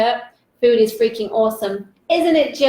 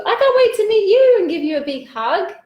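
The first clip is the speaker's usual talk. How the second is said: much higher, sing-out enthusiasm; gushing excitement; 9 semitones higher, 4 dB louder than usual. Real, not acted. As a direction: gush eagerly to a friend you long to hug. Eagerly answers viewer in own words.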